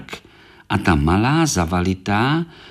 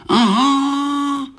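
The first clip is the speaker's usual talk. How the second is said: put-on much higher childlike beetle voice; little beetle remembering; 12 semitones higher than usual. Korálek realising they haven't cleaned, high childlike voice. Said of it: "vzpomněl si Korálek".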